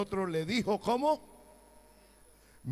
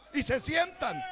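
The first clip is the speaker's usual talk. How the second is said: ordinary delivery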